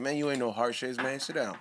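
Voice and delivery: quiet low mock Tyson scolding; low Tyson impression. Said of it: "he's like".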